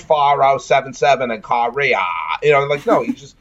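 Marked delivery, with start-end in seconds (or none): 0.0-2.0 lightly put-on Boston accent, slowed; a hint of a hammed-up Boston guy; joking Boston accent as Trapper John; mock Boston accent. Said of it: "attempts a New England voice".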